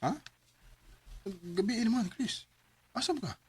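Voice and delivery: ordinary talk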